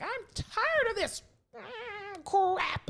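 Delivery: high, drawn-out mock outburst building to a loud final word; exasperated mother. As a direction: imitate a mother at the end of her patience.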